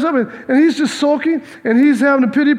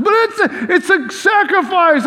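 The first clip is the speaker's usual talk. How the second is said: whiny protest; 8 semitones higher than usual. Retold or imitated. Cain arguing with God.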